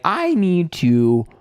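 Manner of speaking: very slow, rising high on the last word; drawn-out urge to escape. Retold or imitated. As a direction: stretch each word, climbing in pitch. Acted alarm at date's remark.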